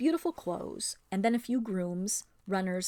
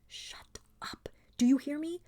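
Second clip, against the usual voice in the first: urgent hissed whisper; furious whisper; 7 semitones higher than usual. A narrator's reading. Aravis hushing Lasraleen, furious whisper. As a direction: whisper fiercely into her ear. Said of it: "whispered furiously in her ear".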